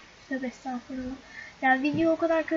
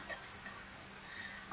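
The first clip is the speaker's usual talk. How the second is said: "Tövbe estağfurullah" breathed out as a slow, much softer whisper; 20 dB quieter, slower than usual. Real, not acted. Fed-up vlogger whispers to herself under her breath. Annoyed vlogger repeats the phrase.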